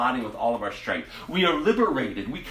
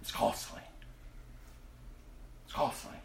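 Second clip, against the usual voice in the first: whispered, low and soft; quiet solemn warning; hushed warning; 9 semitones lower, 16 dB quieter than usual. Real, not acted. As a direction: let it drop to a hush. Sermon, hushed repeated warning.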